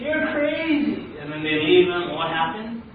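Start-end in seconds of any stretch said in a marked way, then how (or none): 0.0-1.1 high, slow, drawn-out exasperation; mock disbelief, stretched out high; acted incredulity. Helicopter crew leaving stubborn man, incredulous.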